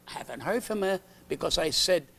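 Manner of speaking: usual speaking voice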